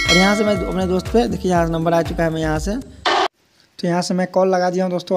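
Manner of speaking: ordinary talk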